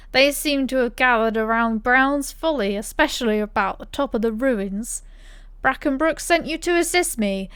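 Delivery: put-on loud, swaggering yokel accent for the NPC; put-on rustic accent. Streamer voicing a rustic NPC to the hero, hammed-up accent. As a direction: ham up a country innkeeper accent. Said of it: "I started doing that accent".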